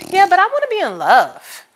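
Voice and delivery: high, loud, pleading "I wanna be in love"; a wistful protest against friends; mock whiny plea. Answering her friends in a high, loud, pleading voice. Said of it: "there's me that's like".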